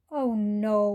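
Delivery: loud mournful wail. A narrator's reading. Poorly cat wailing at the flea, loud. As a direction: wail it out in despair. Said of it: "wailed the cat".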